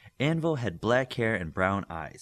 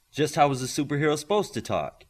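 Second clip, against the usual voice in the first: higher and louder, pressing the point; a little louder; 5 semitones higher, 5 dB louder than usual. Acted. Olympian pressing Midas, a little louder. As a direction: push it up a notch in volume. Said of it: "a little louder".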